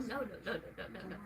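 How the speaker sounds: ordinary delivery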